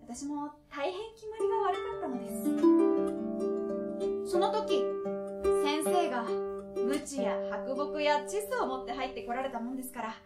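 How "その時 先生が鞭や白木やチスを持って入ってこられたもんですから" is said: ordinary delivery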